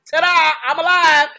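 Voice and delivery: big high shout of ta-da; spring the reveal with a loud, high flourish; loud mock ta-da. Boom springs his survival on Agent Turner, a loud showy ta-da.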